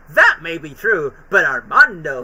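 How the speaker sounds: mock Daffy voice, loud and slow; Daffy quote. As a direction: act out Daffy's stubborn line. Quoting Daffy's line from episode.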